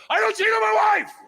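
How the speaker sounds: high, loud yell of loyalty; mock shout. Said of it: "look her in the face in front of everyone and go".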